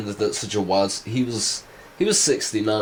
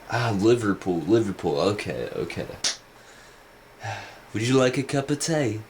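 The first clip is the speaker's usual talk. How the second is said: lightly put-on Liverpool accent; a mild Liverpudlian accent tossed off. Imitating [name]'s Liverpool accent, lightly put on. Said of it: "shanty mate, like, kind of accent".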